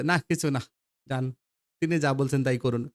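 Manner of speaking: ordinary delivery, nothing marked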